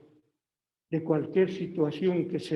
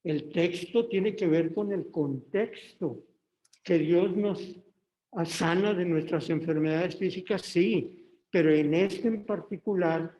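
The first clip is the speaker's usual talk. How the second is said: ordinary delivery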